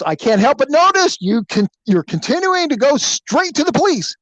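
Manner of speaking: high-pitched, pointed objection; alarmed protest. Retold or imitated. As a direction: imitate a passenger getting nervous. Passenger protesting the driving, retold.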